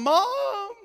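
high, loud desperate call; crying out to mom. Retold or imitated. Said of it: "I cried out to my mom".

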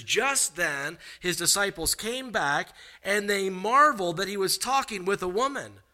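high, energetic sermon delivery; emphatic preaching. Acted. Preaching at raised pitch.

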